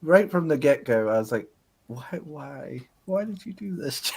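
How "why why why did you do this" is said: higher, softer mock dismay; small high-pitched bewildered protest; hushed dismay. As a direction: plead quietly, as if pained. Acted dismayed reaction to the movie.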